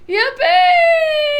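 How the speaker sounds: very high, loud whoop; thrilled old grandpa whooping; shouted yippee. A narrator's reading. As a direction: scream it with pure glee. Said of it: "shouted Grandpa Joe again".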